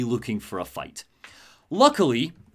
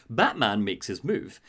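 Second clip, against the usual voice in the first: ordinary talk, nothing marked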